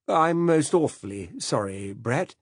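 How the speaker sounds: a fussy, absent-minded British gent apologizing; hesitant upper-class old man, drawn out; vague old Director General. Acted. Narrator voices the Director General.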